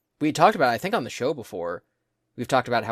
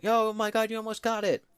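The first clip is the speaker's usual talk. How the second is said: much higher, bright-eyed gasp; anime girl voice; 11 semitones higher than usual. Retold or imitated. [name] mimics the claw machine encouraging the player, high anime voice. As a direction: gasp it like a cute cheerleader machine. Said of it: "high-pitch anime girl doing a voice".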